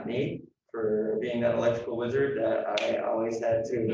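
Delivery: ordinary talk, nothing marked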